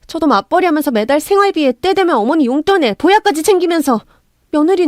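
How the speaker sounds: high, aggrieved protest; indignant outburst. A narrator's reading. Daughter-in-law to mother-in-law, pouring out pent-up resentment. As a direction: build from pleading to a wronged cry. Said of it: "울분을 터뜨렸습니다".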